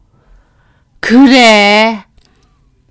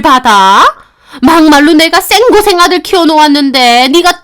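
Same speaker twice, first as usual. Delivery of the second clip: louder, higher, jabbing a finger; pointing and scolding; 6 semitones higher, 5 dB louder than usual. Acted. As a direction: cut in and berate the daughter-in-law. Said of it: "삿대질을 하시더라고요". (삿대질하며 호통치는 시어머니)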